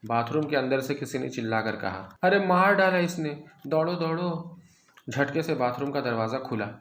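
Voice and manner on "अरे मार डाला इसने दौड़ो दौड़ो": high, loud cry for help; panicked scream. A narrator's reading. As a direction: shriek as if being killed. Old man screams for help.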